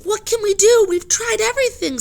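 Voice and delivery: high, very loud despair; desperate reply. Acted. Jack answers Annie, high, very loud and desperate. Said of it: "said Jack".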